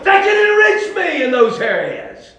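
high, fervent shouted preaching; full-force preaching. Real, not acted. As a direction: cry it out, stretching the phrase endings.

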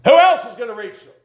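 high, loud climax on else; full-force preacher cry; preaching shout. Acted. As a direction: drive it home to the church.

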